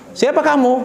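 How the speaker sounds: high-pitched, choosy brush-off; jual mahal. Acted. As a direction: play hard to get with a suitor. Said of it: "Dia jual mahal". (nada tinggi, sok jual mahal)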